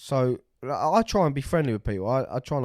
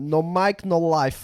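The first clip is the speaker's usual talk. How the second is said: put-on Russian accent; 6 semitones higher, 5 dB louder, slower than usual. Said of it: "he's Russian".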